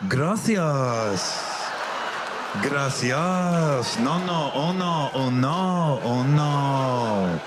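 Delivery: low, put-on Japanese-accented Spanish; mock Japanese accent. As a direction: do a hammed-up Japanese accent, deep and drawn out. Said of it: "speaking Spanish in a Japanese accent".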